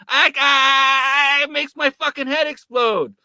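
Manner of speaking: high, loud, slow shout of outrage; screaming in disbelief; furious yell. Real, not acted. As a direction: blow up at him. Exploding anger at Tim Pool.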